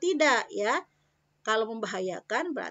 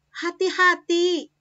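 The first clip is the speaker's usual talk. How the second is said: high, loud, slow "Hati-hati"; firm warning; 7 semitones higher, 7 dB louder, slower than usual. Shown as a demonstration. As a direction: warn sharply to be careful. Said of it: "kalau hati-hati keras ya".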